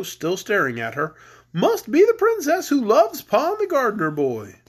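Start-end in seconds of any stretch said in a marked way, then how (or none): 1.5-4.6 high-pitched storybook Scarecrow. Acted. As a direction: swoop up high for the straw man. Voices the Scarecrow.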